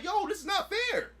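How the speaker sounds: high, slow whine; mock sulky complaint; whiny protest. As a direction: whine like a cheated kid. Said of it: "I'm like".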